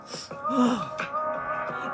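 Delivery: breathy crying gasp; tearful gasp. Acted. Boy overwhelmed by God's presence, tearful gasp. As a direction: catch the breath, moved.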